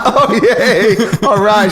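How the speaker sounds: a hammed-up cheer through laughter; mock delight.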